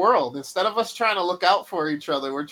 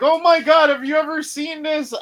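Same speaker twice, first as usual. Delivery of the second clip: excited friend; 9 semitones higher, 6 dB louder than usual. Retold or imitated. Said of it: "they're like".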